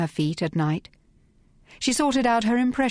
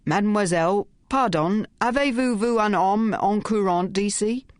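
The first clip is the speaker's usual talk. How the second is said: thug's bad French; 4 semitones higher than usual. A narrator's reading. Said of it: "the tall one answered in that language".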